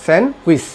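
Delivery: স্যান্ডউইচ as "sen-wis", silent d; correct pronunciation. Shown as a demonstration. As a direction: drop the d from স্যান্ডউইচ. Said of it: "সঠিক উচ্চারণ হলো এটা".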